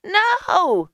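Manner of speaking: very high shouted refusal; shouting. A narrator's reading. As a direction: shriller woman character shouting. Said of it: "she shouted".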